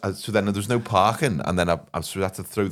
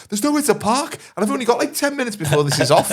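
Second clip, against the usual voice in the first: much higher, louder, frantic rush; panicked man; 5 dB louder than usual. Retold or imitated. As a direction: imitate a flustered man stuck with no parking. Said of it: "panicked, and he was like".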